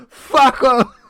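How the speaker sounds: full-volume mock bellow; imitating a furious heckler; imitated angry shout. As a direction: roar it like a fed-up fan. Retelling fan shouting at klaxon man.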